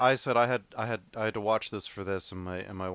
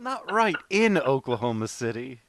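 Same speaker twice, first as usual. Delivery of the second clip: high, loud hammed-up rancher voice; mock rancher voice; 5 semitones higher, 7 dB louder than usual. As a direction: play the gushing movie cowboy, much higher and louder. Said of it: "Jerry's like".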